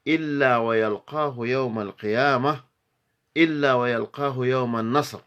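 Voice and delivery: loud, high, brisk verse delivery; Arabic poem recitation. Acted. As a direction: declaim each verse high and quick, pausing between lines.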